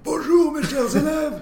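put-on very high, slow, loud old wizard voice; old Dumbledore voice. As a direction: boom it like an ancient headmaster. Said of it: "une voix de vieux Dumbledore".

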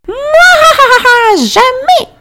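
high cackling witch laugh; gloating villain laugh, then jamais; evil witch cackle. A narrator's reading. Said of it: "ricana la vieille dame".

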